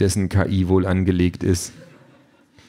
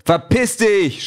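very high, drawn-out yell; angry shout; 14 semitones higher, 5 dB louder, slower than usual. A narrator's reading. Peter tells the pushy drone off, screaming. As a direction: scream it at the drone, stretching the words. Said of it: "schreit Peter".

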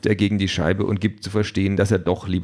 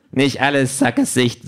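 a higher, louder cheeky machine voice; gadget voice; 5 semitones higher, 3 dB louder than usual. Acted. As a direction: sass him like a smug device. The Quality Pad speaks.

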